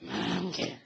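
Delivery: low, soft broken voice; demo of damaged voice. Shown as a demonstration. Preacher shows her damaged voice to the congregation. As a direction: croak it like a lost voice. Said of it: "Bunyi suara saya gini".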